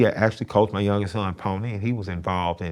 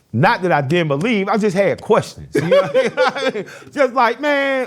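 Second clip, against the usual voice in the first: laughing; 12 semitones higher, 6 dB louder than usual. Real, not acted. Laughing about questioning faith.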